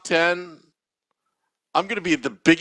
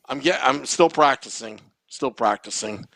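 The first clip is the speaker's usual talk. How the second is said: ordinary talk, nothing marked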